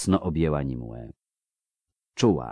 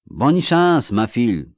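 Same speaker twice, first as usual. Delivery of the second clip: high, raised voice calling after her; called-out farewell; 5 semitones higher, 5 dB louder than usual. A narrator's reading. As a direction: shout good luck after someone leaving. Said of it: "krzyknęła jej w ślad".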